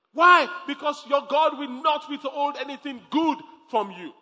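loud, high preaching cry; preaching shout. Real, not acted. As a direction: lift it to a full shout.

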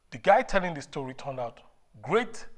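normal talking voice

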